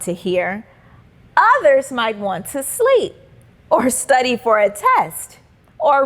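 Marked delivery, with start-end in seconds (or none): none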